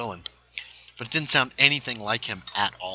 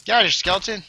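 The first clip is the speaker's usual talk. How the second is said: high, loud order to leave; shouted shooing; 9 semitones higher, 10 dB louder, much faster than usual. Real, not acted. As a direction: shout the pest away. Shooing an enemy in game.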